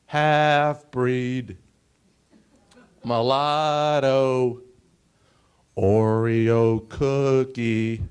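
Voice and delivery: loud, slow, jeering slurs; playground taunts. Retold or imitated. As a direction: spit out each name like a schoolyard bully. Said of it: "That's what I heard on the playground".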